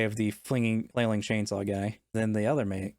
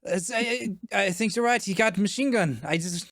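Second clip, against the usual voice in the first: high, rattled and stammering; panicked henchman; 9 semitones higher than usual. Acted. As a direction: blurt it out in a nervous panic. NPC agrees, panicking.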